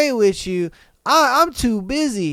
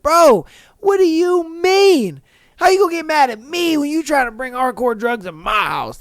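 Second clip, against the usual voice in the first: indignant disbelief; 7 semitones higher than usual. Retold or imitated. Man to date who got mad at him, indignant disbelief. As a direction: retell it as an incredulous, high-pitched objection. Retold quarrel with his date.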